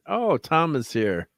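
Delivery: normal talking voice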